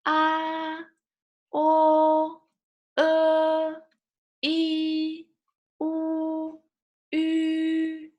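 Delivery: "ah, o, e, i, u, ü" each held high and level; high first tone. Shown as a demonstration. Teacher drills six vowels, each high and flat. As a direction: sustain every vowel on the same high note. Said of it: "first tone you go high up".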